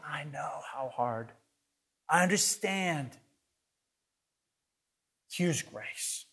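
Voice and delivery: kneeling close, consoling a failing friend softly; tender hushed comfort. Retold or imitated. Jesus on the ground in Gethsemane, comforting the weak in soft tender tones. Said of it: "prostrate on the ground in Gethsemane saying".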